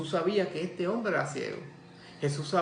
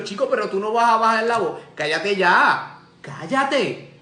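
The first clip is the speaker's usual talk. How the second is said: exasperated scolding; 3 semitones higher, 6 dB louder than usual. Retold or imitated. Crowd keeps telling blind man off, exasperated scolding.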